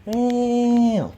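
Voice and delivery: high-pitched imitated cat meows; sing-song mock meowing at a pet. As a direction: meow up high to call the cat. Calling to the cat.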